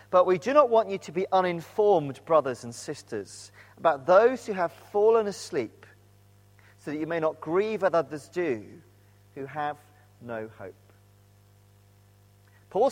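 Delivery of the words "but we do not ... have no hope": measured, deliberate Bible reading; scripture reading. Shown as a demonstration. Preacher reads the verse to the church, measured and deliberate. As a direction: read the verse aloud to the congregation. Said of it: "Let me read the verse again".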